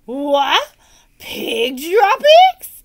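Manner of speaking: high, loud, slow outraged surprise; disgusted knight; exclaiming. Acted. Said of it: "he exclaimed".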